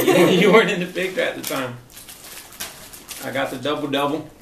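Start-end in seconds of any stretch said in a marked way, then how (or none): 0.1-1.8 laughing while teasing. Real, not acted. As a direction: say it through a laugh.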